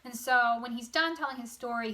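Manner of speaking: ordinary talk, nothing marked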